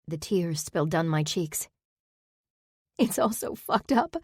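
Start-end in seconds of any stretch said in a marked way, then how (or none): 3.0-4.1 higher, crying voice; tearful. Acted. Saber crying against Zack's shoulder, tearful. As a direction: let the tears push the pitch up.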